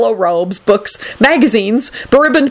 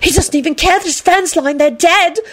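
put-on high, shrieky girlish outrage; angry yell; 14 semitones higher than usual. A narrator's reading. Tall blonde condemning the professor, angry yell. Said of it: "tall blonde yelled angrily".

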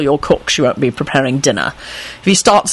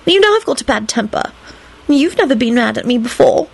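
hurt voice; 7 semitones higher than usual. A narrator's reading. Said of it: "she added in a hurt voice".

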